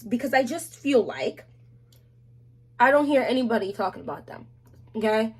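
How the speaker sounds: normal talking voice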